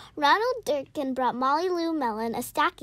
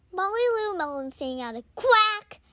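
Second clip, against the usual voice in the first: ordinary delivery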